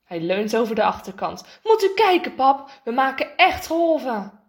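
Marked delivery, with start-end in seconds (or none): 1.6-4.4 put-on higher, louder boy's excitement; eager, high-pitched call; excited calling. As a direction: call dad over to see. Excited boy calling his dad.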